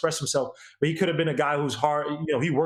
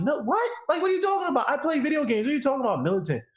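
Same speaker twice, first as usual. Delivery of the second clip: put-on retelling an old reply, baffled and much higher; incredulous; 10 semitones higher than usual. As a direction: pitch it way up in outraged surprise. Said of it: "I'm like".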